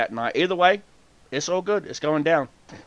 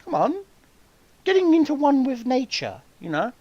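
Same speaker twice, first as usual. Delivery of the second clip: higher, airy casual voice; breezy mock innocence; 12 semitones higher than usual. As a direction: act like it's just brunch. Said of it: "I'm like".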